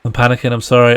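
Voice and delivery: ordinary talk, nothing marked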